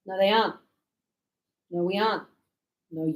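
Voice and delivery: ordinary talk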